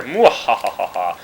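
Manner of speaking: slow villain laugh; evil cackle. Pretend. Villain laugh after graffiti.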